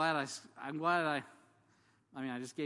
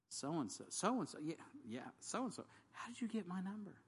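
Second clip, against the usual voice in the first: high, puzzled repeating of the name; baffled recognition; 7 semitones higher, 3 dB quieter than usual. Retold or imitated. To the late-night caller, high puzzled surprise.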